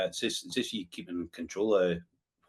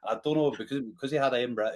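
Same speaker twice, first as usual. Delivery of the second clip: ordinary talk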